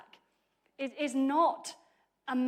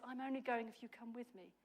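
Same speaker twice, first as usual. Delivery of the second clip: much softer read; soft quote; 2 semitones lower, 14 dB quieter, faster than usual. Shown as a demonstration. Preacher repeats Barak's words softly to be weighed. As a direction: murmur Barak's condition quietly. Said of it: "How do you hear that".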